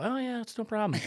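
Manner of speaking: high put-on voice for the boss; mimicked obliging boss. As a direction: play the boss breezily agreeing. Boss's easy reply acted.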